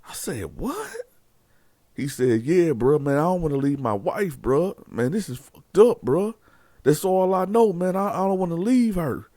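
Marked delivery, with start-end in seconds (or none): 0.6-1.0 high incredulous What; stunned. Retold or imitated. Cellmate hearing Pep won't leave, stunned high squeak. 2.4-9.3 distraught. Retold or imitated. Pep upset about leaving his wife.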